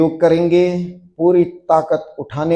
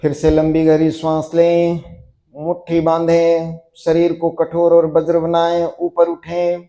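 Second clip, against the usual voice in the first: steady chant-like commands building louder to the release; building yoga drill; 2 semitones higher, 4 dB louder than usual. Acted. Yoga teacher building students' tension before the drop, mounting drill cueing. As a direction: drive the tension up, then call the drop.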